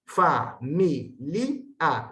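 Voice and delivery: fa-mi-li-a, each syllable separated; syllable split demo. Shown as a demonstration. Teacher splitting familia into syllables for students. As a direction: familia broken into four syllables. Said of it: "debe considerarse como una sílaba separada".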